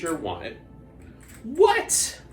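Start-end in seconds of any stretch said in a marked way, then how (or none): 1.4-2.2 mock surprise. Pretend surprise.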